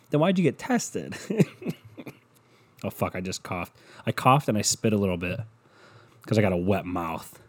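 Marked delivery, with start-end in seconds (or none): 0.0-1.7 laughing. Real, not acted. Mocking Elba's test claim.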